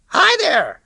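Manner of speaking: high, loud, breezy greeting. Acted. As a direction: sound carefree in her voice. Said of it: "as breezily as I could".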